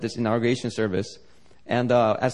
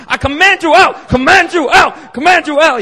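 high, loud and slowing on the repeated command; aggressive deliverance shout; 12 dB louder than usual. Retold or imitated. Pastor slapping the possessed woman, shouting the command. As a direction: hammer each command harder as if in her face. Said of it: "like, aggressive".

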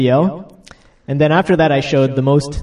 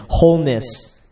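ordinary delivery

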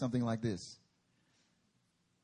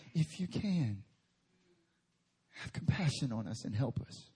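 meek plea; 4 semitones lower than usual. Acted. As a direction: ask timidly. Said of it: "Very different than".

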